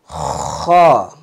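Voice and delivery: Kha scraped from deep in the throat. Shown as a demonstration.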